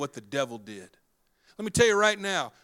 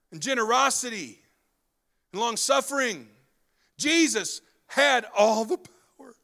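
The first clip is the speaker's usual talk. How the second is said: slow, louder, lifting list; preacher swelling toward the climax; preaching climax; 4 semitones higher, 4 dB louder, slower than usual. Acted. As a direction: build each virtue slowly.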